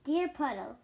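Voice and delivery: ordinary delivery, nothing marked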